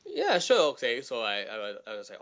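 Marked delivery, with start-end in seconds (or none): 0.1-1.0 eager reply. Retold or imitated. Acts his eager reply at audition.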